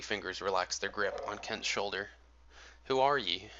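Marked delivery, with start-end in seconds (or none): 2.8-3.5 put-on startled old man with a Yankee twang, higher and louder; old Yankee fisherman, sudden and loud. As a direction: jolt awake and demand in a Yankee accent. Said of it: "unmistakable twang of a Yankee accent".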